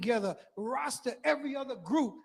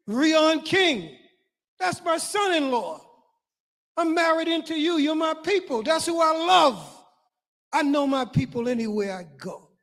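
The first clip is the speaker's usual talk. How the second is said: raised, high-pitched proud proclamation; impassioned preaching; 5 semitones higher, 6 dB louder than usual. Real, not acted. Speaker proudly claiming Guyanese family before the audience, impassioned preaching. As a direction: preach it high and loud to the crowd.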